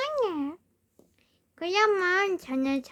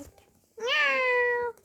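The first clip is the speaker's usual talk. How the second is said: cat meow; 5 semitones higher, much slower than usual. Pretend. Plays a cat meowing.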